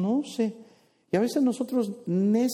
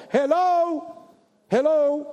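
high, sing-song hello hello, louder; a playful call of hello to someone guilty; teasing hello; 7 semitones higher, 3 dB louder, much slower than usual. Acted. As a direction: tease them with a knowing hello. Teasing the angry listener.

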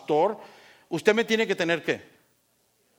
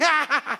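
re-enacted hard laugh; 8 semitones higher than usual. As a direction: act out laughing too hard. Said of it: "me reí tan fuerte".

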